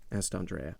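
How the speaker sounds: ordinary delivery, nothing marked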